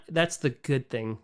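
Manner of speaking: normal talking voice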